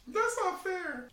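high, slow squeaky whine; silly whiny voice. Pretend. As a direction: whine like a sulky cartoon kid. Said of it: "happy and sad about our teams".

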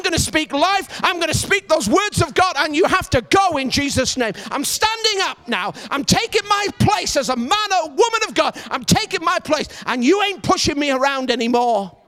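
full-force preacher cadence, rising and proclaiming; preaching. Acted. Preacher proclaiming to the congregation with force.

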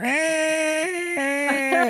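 high squeaky mew of a pet cat; cat's call. Retold or imitated. Imitating her cat running up, a high mew. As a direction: squeak it out like a little cat calling. Cat calls again later.

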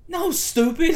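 high, loud retold put-down; exasperated friend, laughingly imitated; mock scornful retort. Friend scolds caller over silly phone request, mock scornful.

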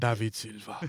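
Spanish accent. Shown as a demonstration. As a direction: give the name a playful Spanish accent. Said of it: "you gotta say it in a Spanish accent".

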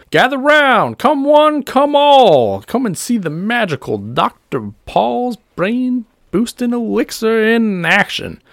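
high, ringing, drawn-out crowd call; carnival barker. Acted. Doctor Paul drawing crowds for his demonstration, barker's high holler. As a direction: holler them in like a showman. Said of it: "begins to draw crowds".

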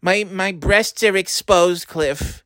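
put-on high old grandma pleading for help; whiny elderly grandmother calling her grandson. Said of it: "these fucking voices".

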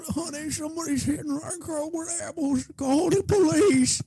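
put-on very high, slow little-old-lady voice; old grandma. Old grandma phoning the police about apple-throwing kids, high and slow. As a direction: quaver it like a fussy old grandma calling the cops. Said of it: "some old grandma's like".

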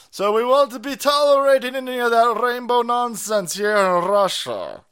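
high, loud hammed-up Russian accent; lampooning Russian official voice, loud and high; mock Russian accent. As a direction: put on a cartoon Russian accent. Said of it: "We're going with the Italian thing".

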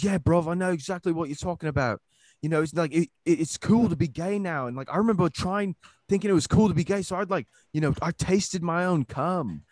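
high put-on London accent, wasted bloke; a drunk Brit bonding over a joke; drunk London guy. Drunk guy after the show, high put-on London accent. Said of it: "he's from, like, London".

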